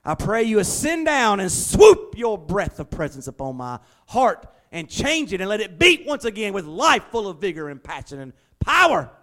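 hammed-up ornate prayer building to a loud plea; mock pretty church prayer. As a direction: lampoon the flowery churchy prayer. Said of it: "one of those pretty church prayers".

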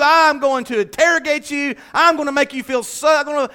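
loud, high hammed-up wrath; mock furious dressing-down; mock drill sergeant. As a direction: threaten like a drill sergeant playing angry. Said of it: "didn't come like a drill sergeant".

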